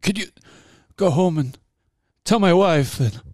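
hammed-up dying plea, high and loud; dying soldier. Mocking a shot soldier's last message home, melodramatic plea. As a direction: gasp out last words in corny movie style. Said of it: "someone gets shot and they're like".

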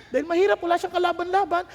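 ordinary talk

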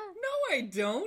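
high, loud comic denial; indignant protest. Real, not acted. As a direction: protest loudly, voice shooting up. Protests being likened to Mister Clean.

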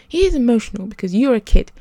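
emphatic, exasperated retort; indignant retort. A narrator's reading. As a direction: push back hard at the other person. Boy retorts in heated exchange.

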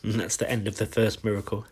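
ordinary delivery

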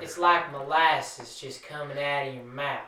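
slow Southern drawl; lazy, molasses-slow country accent; country accent demo. Shown as a demonstration. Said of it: "So you could do, like, a".

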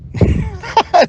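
sudden high burst of laughter; a quick high giggle at his own joke. Real, not acted. Laughing at his own pep talk to himself, high laugh.

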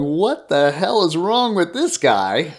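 high, loud, drawn-out mock bewilderment; incredulous retold reaction, stretched out; amused disbelief. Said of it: "start laughing and going".

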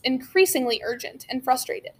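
ordinary delivery

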